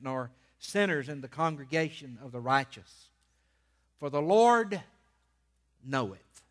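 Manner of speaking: measured reading with long pauses and punched key words; emphatic scripture reading. Real, not acted. Preacher reading the first Psalm to his church, emphatic proclamation. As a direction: proclaim the verses phrase by phrase.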